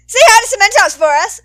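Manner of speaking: loud, high called-out farewell; calling after. Acted. Hizashi calling after the departing Midnight. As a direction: call across the room to her. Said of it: "Hizashi called after".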